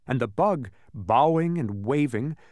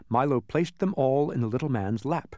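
plain reading voice

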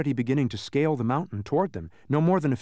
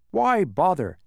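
raised, louder brush-off; dismissive; 5 semitones higher, 5 dB louder, slower than usual. Acted. Census taker tempting Milo off his path, dismissive. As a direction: wave the idea away.

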